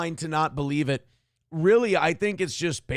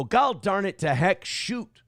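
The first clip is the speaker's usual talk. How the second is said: folksy Minnesota Fargo exclamation; Fargo accent; 3 semitones higher than usual. Shown as a demonstration. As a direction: say it like a polite Fargo local. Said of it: "When I go".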